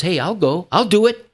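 high, keen offer to go; volunteer readily, pitch climbing; eager volunteering. Acted. Preacher voicing Isaiah's answer to God, eager volunteering.

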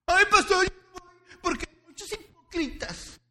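high, loud whiny mock; complaining churchgoer. Pastor mocking a member complaining about hypocrites, high whine.

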